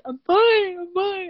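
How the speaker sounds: crying while insisting you're fine; retold sobbing, high and tearful; imitating sobbing. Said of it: "As I'm sobbing".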